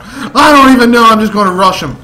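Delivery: loud and high, giving up; exasperated outburst. Real, not acted. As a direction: blurt it out in frustration. Frustrated outburst mid-rant.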